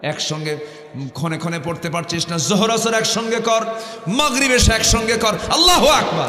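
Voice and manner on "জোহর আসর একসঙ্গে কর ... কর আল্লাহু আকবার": full-force preaching. Acted. Preacher voicing God's call to the congregation, fervent. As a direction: preach it out with fervour.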